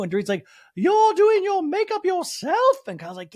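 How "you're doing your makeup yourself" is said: much higher, louder and slow, a drawn-out put-on voice; mock Dorit voice. Host mimicking Dorit surprised at Kyle, high and slow. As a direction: stretch it out in a high, prissy put-on.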